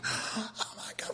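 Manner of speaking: breathless slow whisper; hushed whisper of disbelief; whispered aside. Retold or imitated. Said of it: "just going".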